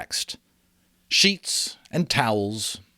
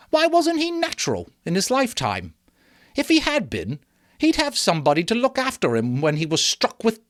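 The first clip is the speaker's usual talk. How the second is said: higher mock indignation; scornful woman; 6 semitones higher than usual. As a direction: rail against the dead miser. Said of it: "pursued the woman".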